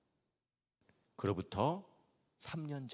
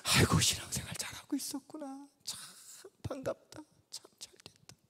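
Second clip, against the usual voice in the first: hushed, breathy whisper of relief; 12 semitones higher than usual. Retold or imitated. Old pastor whispering his relief about the mother. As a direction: murmur it almost to yourself.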